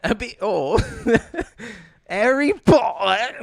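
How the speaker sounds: high, hammed-up British accent with laughter; mock British. Host imitating every British person, exaggerated British. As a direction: laugh through a silly British impression. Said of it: "This is like every British person".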